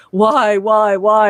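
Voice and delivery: imitating a loud, hammed-up lament; mock anguished plea. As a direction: draw each WHY out in mock despair. Imitating co-host's dramatic note.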